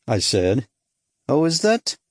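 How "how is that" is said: put-on Eastern European accent. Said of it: "definite flavor of Eastern Europe in his voice".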